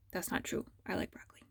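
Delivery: playful whispered confession; hushed joking aside. Real, not acted. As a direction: whisper it as a joke to the class. Teacher's joking aside during dictation.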